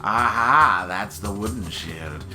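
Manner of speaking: low, suave merchant voice; creepy salesman pitch; sinister shopkeeper. Acted. Reads shopkeeper dialogue.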